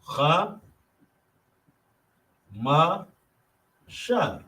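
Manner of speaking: Cha, ma, sha with a pause after each; syllable by syllable. Shown as a demonstration. Teacher breaking Chamash into syllables. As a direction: sound out each beat of Chamash.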